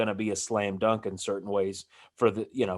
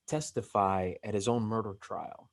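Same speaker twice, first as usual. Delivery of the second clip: whispering; 4 semitones lower, 7 dB quieter than usual. Real, not acted. Drops to hushed aside.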